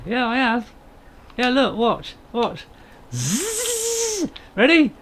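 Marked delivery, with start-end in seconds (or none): 3.1-4.3 very high buzzing chainsaw imitation; chainsaw noise. Gamer showing viewers the tree chop, high chainsaw buzz.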